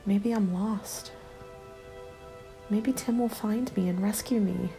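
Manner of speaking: ordinary delivery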